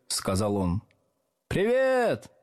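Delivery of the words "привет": high, drawn-out squeal; squeaky greeting. A narrator's reading. Back desks greeting the new teacher, squeaky and high. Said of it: "запищали с задних парт".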